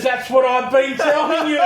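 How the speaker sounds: high, loud exasperated yell; fed-up shop worker shouting at the old lady; shouted punchline. Retold or imitated. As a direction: yell it like you've run out of patience. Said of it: "He goes".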